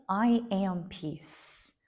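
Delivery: very slow, firm statement; confident, emphatic. Shown as a demonstration. Teacher demonstrating a sankalpa, calm and emphatic. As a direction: give "I am peace" slowly and with full conviction. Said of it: "confident, emphatic".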